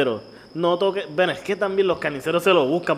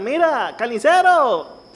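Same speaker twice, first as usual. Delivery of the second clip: put-on calling out old man; 7 semitones higher, much slower than usual. Imitating old people yelling at butcher.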